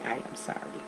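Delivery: "I am sorry" said softly, every word spelled out; soft sincere apology. Shown as a demonstration. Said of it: "is to say".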